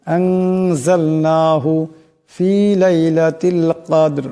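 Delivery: আনজালনাহু with the nun before জা nasalised one alif long; ghunnah on the nun. Shown as a demonstration. Teacher modelling the nasal nun before জা for students. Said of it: "এক আলিফ পরিমাণ গুণনা হবে".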